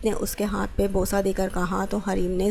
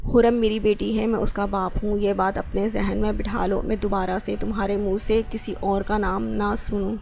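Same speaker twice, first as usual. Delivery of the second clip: ordinary delivery, nothing marked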